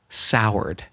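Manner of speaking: ordinary talk, nothing marked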